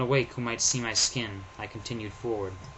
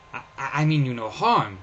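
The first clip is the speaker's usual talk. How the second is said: stammering; 3 semitones higher, 6 dB louder than usual. Acted. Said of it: "he stammered".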